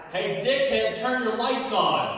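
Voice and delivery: a hammed-up angry driver shouting; mock yell from a car. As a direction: call out across the cars to the lazy driver. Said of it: "And then he yelled".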